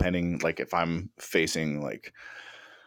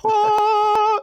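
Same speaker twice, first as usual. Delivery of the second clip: high sung heavenly ah; heavenly choir ah; 8 dB louder than usual. Retold or imitated. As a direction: belt a high angelic ah. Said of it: "the fucking heavens open".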